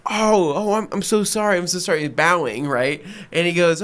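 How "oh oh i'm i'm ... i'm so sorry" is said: high, repeated apologising; mock-contrite customer; imitated profuse apology. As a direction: play a flustered customer begging pardon. Customer apologising while bowing.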